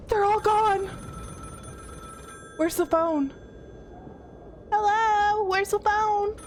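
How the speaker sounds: frightened cry. Real, not acted. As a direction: let the fear crack through. Player finds room empty.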